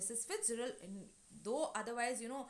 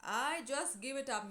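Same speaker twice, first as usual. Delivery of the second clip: raised, exasperated giving up, lightly acted; despairing; 5 semitones higher, 3 dB louder than usual. George has lost all hope, despairing. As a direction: throw in the towel, mock despair. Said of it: "George in despair".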